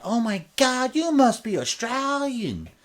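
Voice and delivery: high-pitched imitation of astonished non-Australians; mock amazed foreigner. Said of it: "people who aren't from Australia".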